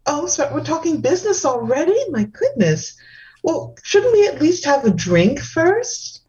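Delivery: high, airy, dainty hostess; delicate femme voice. Acted. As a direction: gush sweetly like a dainty socialite. Same dainty character.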